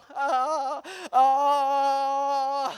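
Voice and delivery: loud, long imitated bawling; swelling mock cry; mock baby wail. Imitates his crying baby.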